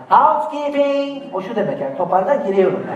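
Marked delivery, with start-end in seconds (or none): 0.1-1.3 high, slow drawn-out "Housekeeping!" through the door; hotel maid calling. Retold or imitated. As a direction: call it out like staff at a hotel door. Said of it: "Hani vardır ya odanın dışından". (kapının dışından yüksek ve uzatarak "Housekeeping!")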